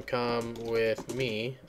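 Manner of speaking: each word drawn out slowly while typing; slow dictation. Shown as a demonstration. Reading out a phrase he types for the computer, slowly. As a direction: spell the phrase out slowly as you type it.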